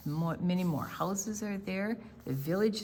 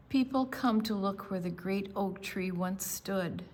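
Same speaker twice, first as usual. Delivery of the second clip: clear, steady storybook reading, a bit louder; story narration; 2 semitones lower, 3 dB louder than usual. A narrator's reading. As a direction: read the picture book to the class. Read-aloud story narration.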